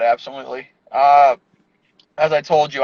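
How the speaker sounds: ordinary delivery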